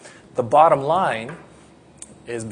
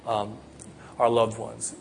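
normal talking voice

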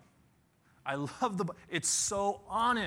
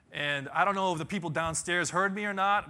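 ordinary talk, nothing marked

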